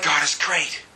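low, unexcited mock praise; half-hearted congregation. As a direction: say it low, without any zeal. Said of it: "We're like".

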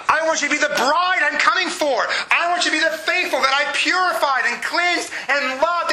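impassioned plea. Acted. Voicing Jesus pleading to believers.

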